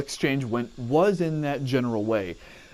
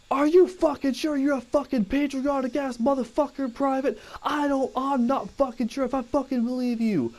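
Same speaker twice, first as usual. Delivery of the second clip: drill sergeant; 11 semitones higher than usual. Retold or imitated. Drill sergeant yelling at recruit.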